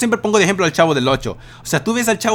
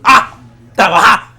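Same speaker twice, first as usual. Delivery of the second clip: angry parent; 3 semitones higher, 5 dB louder than usual. Retold or imitated. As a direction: yell at the lazy kid like a fed-up father. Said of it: "los padres de familia dicen". (gritando como un padre enojado)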